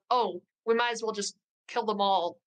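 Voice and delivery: ordinary talk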